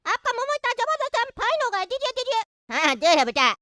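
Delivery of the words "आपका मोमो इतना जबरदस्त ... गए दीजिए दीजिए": high-pitched cartoon child voice; squeaky kid coaxing a vendor; high, chatty child; eager little boy.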